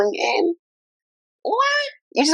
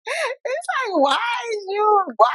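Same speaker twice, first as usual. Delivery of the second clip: voice breaking; 12 semitones higher, 4 dB quieter, slower than usual. Real, not acted. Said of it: "her own voice breaking".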